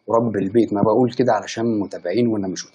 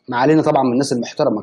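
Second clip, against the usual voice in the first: normal talking voice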